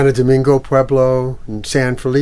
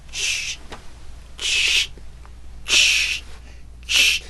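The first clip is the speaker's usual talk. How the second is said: mock scraping; 6 dB quieter, much slower than usual. Strange scraping sound effect.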